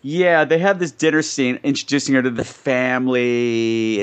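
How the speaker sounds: ordinary talk, nothing marked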